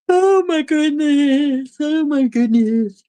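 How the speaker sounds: slow, high drawn-out exclamation; high-pitched gushing. Real, not acted. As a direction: start very high, ease down.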